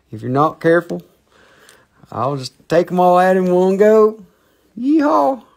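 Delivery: hammed-up Texan cowboy threatening to take them all out; mock cowboy accent. Crystal seller showing viewers one of his accents, playful American cowboy yell. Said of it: "I have many accents".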